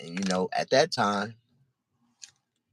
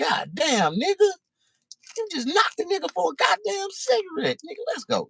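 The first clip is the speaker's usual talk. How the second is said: much higher, stunned outcry; shocked disbelief. Retold or imitated. The teller reacting to Doobie's shooting, stunned and high. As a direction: blurt out shock then hurry off. Narrator's younger self shocked.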